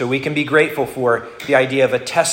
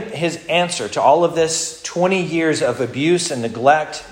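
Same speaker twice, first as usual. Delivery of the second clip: usual speaking voice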